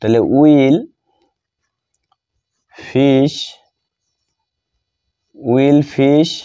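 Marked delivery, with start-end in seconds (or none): none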